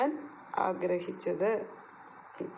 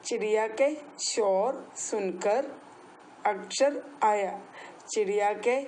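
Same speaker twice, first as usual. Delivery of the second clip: slow drill; slower than usual. Shown as a demonstration. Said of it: "എന്നാ പറഞ്ഞേ".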